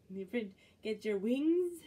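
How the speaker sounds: ordinary delivery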